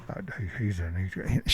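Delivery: low, very soft indistinct mumble; mumbling. Retold or imitated. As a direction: mumble to someone off the phone. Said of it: "she was mumbling".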